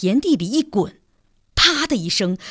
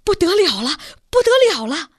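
alarmed rapid repeats; 6 semitones higher, 4 dB louder than usual. A narrator's reading. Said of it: "一连串地说".

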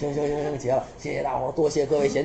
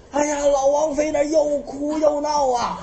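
ordinary talk

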